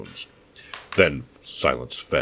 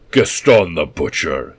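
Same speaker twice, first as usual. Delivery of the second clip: slow, much louder shout; 2 semitones higher, 8 dB louder, slower than usual. Acted. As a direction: shout it over the corpse. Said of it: "he shouted".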